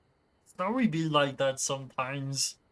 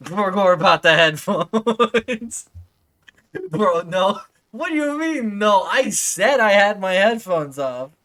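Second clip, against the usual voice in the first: indignant; 2 semitones higher, 8 dB louder than usual. Real, not acted. Arguing with chat, laughing.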